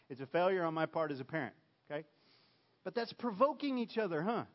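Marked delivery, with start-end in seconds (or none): none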